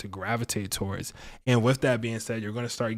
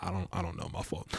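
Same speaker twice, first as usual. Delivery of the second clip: sheepish mumble; 6 semitones lower, 12 dB quieter than usual. Pretend. Embarrassed reply to the mogul.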